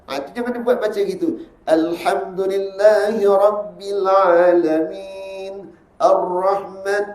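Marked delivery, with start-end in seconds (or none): 1.6-7.0 slow, drawn-out Quran reading; dragging recitation. Shown as a demonstration. Teacher shows students the dragging style to avoid. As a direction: drag every syllable on purpose. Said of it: "ada suka menyeret".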